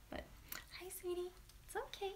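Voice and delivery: soft high sweetie voice; cooing to the dog. Real, not acted. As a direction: coo sweetly at the pup.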